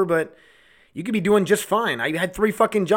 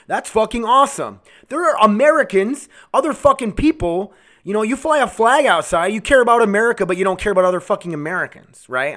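raised, indignant voice; angry rant; 4 semitones higher, 4 dB louder than usual. Real, not acted. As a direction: berate the listeners, loud and heated. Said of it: "I'm just angry right now".

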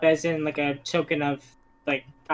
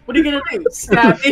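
mocking dare; 8 semitones higher, 7 dB louder, faster than usual.

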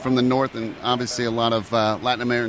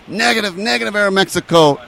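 mock controller yell; 7 semitones higher, 5 dB louder than usual. Controller yelling over radio.

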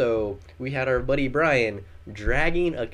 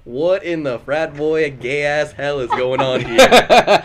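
loud, slow disbelief; retold bewilderment; 8 dB louder, much slower than usual. Storyteller voicing the outfitter seeing two paddlers, bewildered. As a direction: stretch it out, building louder to the end.

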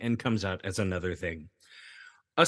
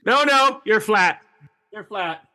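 loud, raised-pitch mock correction; mock choir director; 7 semitones higher, 6 dB louder than usual. Choir director correcting the fart choir, mock-stern and loud. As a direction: cut in loudly as a pretend conductor.

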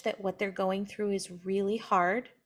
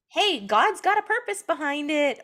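glib platitude; 6 semitones higher, 5 dB louder than usual. Retold or imitated. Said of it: "You don't have to say".

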